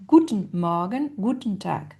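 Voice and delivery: "Guten Morgen, Guten Tag" with GU- accented; first-syllable stress. Shown as a demonstration. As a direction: hit GU- at the start of each "Guten". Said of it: "un accent sur la première syllabe".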